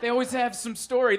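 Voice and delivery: ordinary talk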